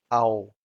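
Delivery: au glided as one syllable, like "ow"; slow, drawn-out au in one go; diphthong au. Shown as a demonstration. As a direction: say au as a single gliding vowel. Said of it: "the first syllable is a diphthong".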